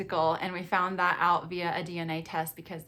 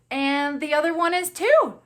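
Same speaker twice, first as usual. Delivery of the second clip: higher, louder punchline; excited reveal; 7 semitones higher, 8 dB louder than usual. Retold or imitated. Wife telling husband by phone about twins, excited reveal. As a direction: drop the big news brightly and loudly.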